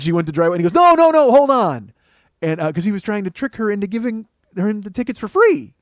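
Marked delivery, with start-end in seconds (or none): 0.7-1.9 high, loud plea to stop; frantic calling out. Retold or imitated. Haggling kid stopping the wife driving off, frantic shout. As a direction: yell after someone driving off. Kid calls after departing driver.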